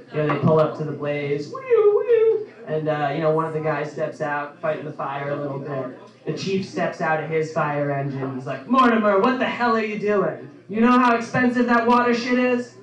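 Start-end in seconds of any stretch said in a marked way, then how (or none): 1.5-2.5 high wailing woo woo like a fire engine siren; siren imitation. Fire engine arriving at blaze. 8.7-12.8 higher, louder mock chief bellowing at his man; hammed-up boss yelling across the fire. Fire chief scolding a firefighter.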